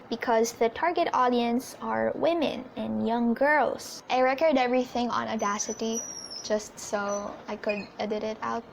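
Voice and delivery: usual speaking voice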